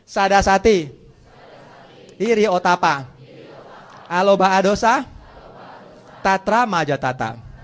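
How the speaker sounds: call-out recitation. Shown as a demonstration. Teacher leads students repeating memorised list, call-out recitation. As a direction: call each term out for the class to repeat.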